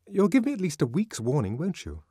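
playful, coaxing ask for warning; another tone. Acted. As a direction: switch to a bright, wheedling tone. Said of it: "she went on in another tone".

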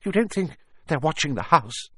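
high, anxious question; quivering voice. A narrator's reading. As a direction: ask it fearfully, trembling. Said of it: "saying in a quivering voice".

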